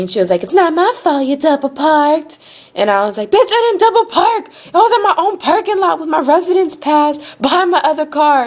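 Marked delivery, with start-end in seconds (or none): 0.4-2.4 high mock country-accent yell. Tow lady blames customer for double parking, yelling in country accent. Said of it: "she just kept yelling at me". 3.3-8.5 high, heated retold argument; angry yelling. Customer argues with tow lady over parking, angry yelling. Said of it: "getting more and more angry".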